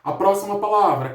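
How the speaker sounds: normal talking voice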